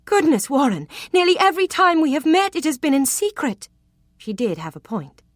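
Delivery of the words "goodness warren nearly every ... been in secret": high, loud, arch and amused; a coquette needling a stuffy man; playful teasing. Acted. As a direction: tease him with mock shock. She teases him.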